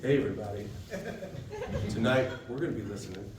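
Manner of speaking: a lightly done low, smooth radio host; mild late-night radio announcer. Shown as a demonstration. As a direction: croon it like a midnight radio DJ. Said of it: "the late night D J voice".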